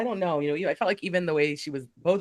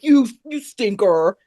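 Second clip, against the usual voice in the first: slow, higher, louder mock scolding; imitated pouty scolding; 4 semitones higher, 7 dB louder, slower than usual. Host imitating Candace with crossed arms, slow mock huff. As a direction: drag it out like a huffy pout. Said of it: "crossed her arms and was like".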